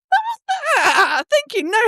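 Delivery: high, squeaky mock-offended no; indignant denial. Mother squeaks a mock-offended denial at daughter. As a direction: act insulted by the accusation.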